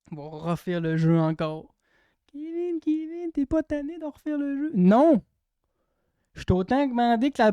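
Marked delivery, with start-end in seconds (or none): 2.3-4.7 high, whiny nagging. Retold or imitated. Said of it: "voices nagging people". 4.7-5.2 defiant shout. Pretend. Defiant answer.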